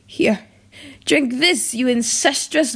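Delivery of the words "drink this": plain reading voice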